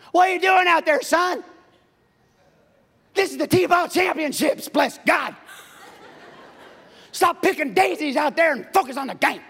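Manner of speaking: put-on loud, high shouting at a kid on the field; yelling sports parent. Parent yelling at son during T-ball, loud shout. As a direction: bark like a T-ball dad. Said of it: "The way parents talk to their kids".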